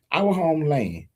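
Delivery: ordinary delivery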